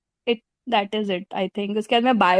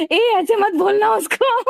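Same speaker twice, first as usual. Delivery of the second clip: playful yell; 8 semitones higher than usual. Retold or imitated. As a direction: snap at him to stop, half laughing. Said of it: "मैं चिल्लाई". (ऊँची आवाज़ में मज़ाकिया डाँट)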